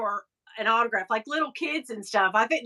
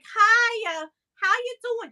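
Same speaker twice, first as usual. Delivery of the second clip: put-on higher, light New York accent; 9 semitones higher, slower than usual. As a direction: greet like a chatty New Yorker. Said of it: "that sort of thick, kinda weird New York".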